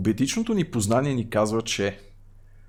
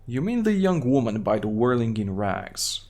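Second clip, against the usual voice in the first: normal talking voice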